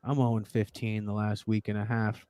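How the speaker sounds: low, soft loser's admission; mock dejection. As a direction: imitate a downcast friend confessing losses. Voicing losing friends in the chat.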